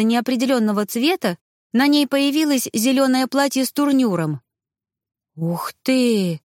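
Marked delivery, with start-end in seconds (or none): 5.4-6.4 dumbfounded little exclamation; stunned. A narrator's reading. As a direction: let it out, quite taken aback. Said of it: "только и смогла я произнести ошарашенно".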